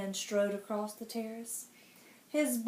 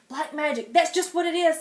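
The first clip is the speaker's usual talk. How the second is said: relieved sigh; 5 semitones higher, 8 dB louder than usual. Acted. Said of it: "sighed the king with relief".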